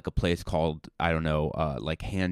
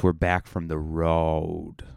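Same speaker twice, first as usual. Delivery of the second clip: slow, low, knowing "We're back from the road."; slow, lowered road-comic delivery; road comic phrasing; 3 semitones lower, slower than usual. Shown as a demonstration. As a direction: drawl it like a seasoned road comic. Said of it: "that's how you say it".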